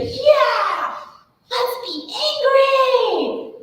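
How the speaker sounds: high, loud and slow, hamming up the fury; mock angry cheer. Shown as a demonstration. Parent inviting an angry child to be angry, hammed up. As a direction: cheer the anger on like a game. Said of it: "you say".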